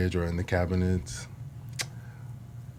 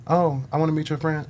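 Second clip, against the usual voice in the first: sweet mom voice; 9 semitones higher, 3 dB louder than usual. Retold or imitated. Mom's fake-sweet quote.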